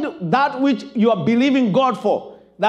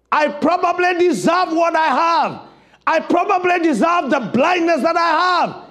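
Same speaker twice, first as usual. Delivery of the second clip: desperate man's cry; 9 semitones higher than usual. Acted. Blind man pleading with Jesus, desperate raised cry. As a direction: beg for mercy you don't deserve. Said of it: "he cried out the cry of a, of a desperate man".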